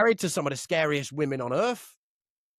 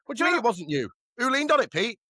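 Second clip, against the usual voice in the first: accusing question; 6 semitones higher, 3 dB louder than usual. Retold or imitated. Mum questioning Pete in story.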